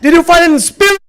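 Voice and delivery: oficial da imigração. Retold or imitated. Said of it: "E ele olhou pra mim".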